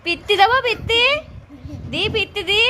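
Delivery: high, loud pretend threat; tease-threaten a giggling toddler; playful threat.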